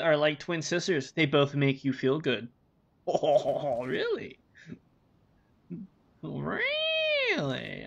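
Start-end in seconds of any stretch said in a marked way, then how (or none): 3.1-7.8 surprised. Real, not acted. Mock surprise at joke.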